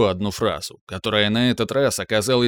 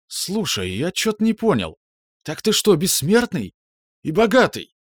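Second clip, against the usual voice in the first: excited; 8 semitones higher than usual. Acted. Stunned friend asks excitedly.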